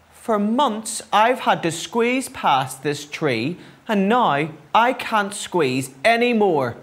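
lightly shrieked protest; mild storybook shriek. A narrator's reading. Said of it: "she shrieked".

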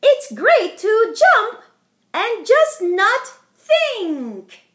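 playful leaping glee; happy jumping. A narrator's reading. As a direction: bounce with joy. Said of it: "when my days are happy".

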